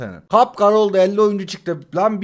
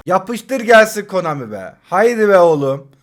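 ordinary talk, nothing marked

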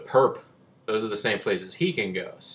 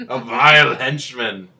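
dramatic announcement; 2 semitones higher, 11 dB louder, slower than usual. Pretend. Dramatic reading.

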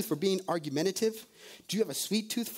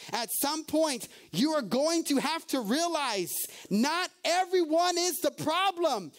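preaching at force; 6 semitones higher than usual. Acted. Preacher confronting the church, emphatic and rising. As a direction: drive home you the problem.

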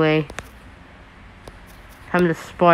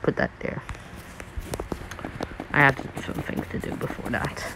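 soft whispered aside; whispering; 5 semitones lower, 13 dB quieter, faster than usual. Real, not acted. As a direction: whisper it to yourself.

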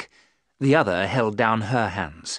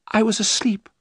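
high, trembling Mina; frightened young wife; 11 semitones higher than usual. Acted. Mina telling the men of her terror, high-voiced. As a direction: recount the nightmare, much higher.

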